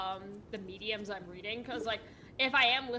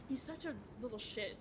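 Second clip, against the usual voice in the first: usual speaking voice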